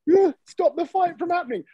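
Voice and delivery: high-pitched appeal, at normal volume; mock plea. Joker asks the doorman to stop a fight, high mock plea. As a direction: pitch it well up as a retold plea.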